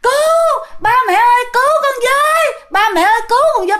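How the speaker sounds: high-pitched mock scream; re-enacted panicked wail, pitched high; terrified cry for help. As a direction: yell for parents to save you. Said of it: "bạn la vậy nè".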